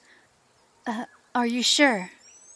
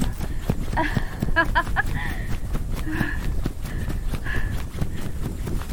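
breathy predatory laugh; 5 semitones higher, 4 dB quieter than usual. Acted. Laughs while prey runs.

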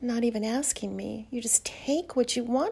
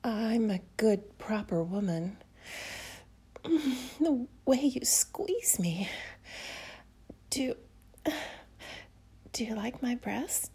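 sultry whisper; 4 dB quieter than usual. Acted. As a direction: whisper close and seductive. Whispers intimately throughout.

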